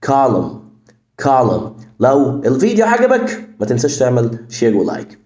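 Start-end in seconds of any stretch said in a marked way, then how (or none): none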